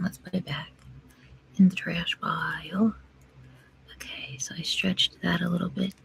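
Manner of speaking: soft ASMR-style whisper. Acted. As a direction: whisper close to the mic. Whispering while doing nails.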